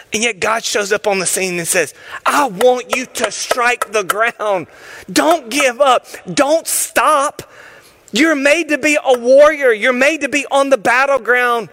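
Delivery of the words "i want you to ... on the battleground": rousing call. Acted. God urging the listener not to give up. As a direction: build into a battle cry.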